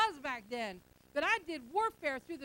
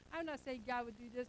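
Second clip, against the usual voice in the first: normal talking voice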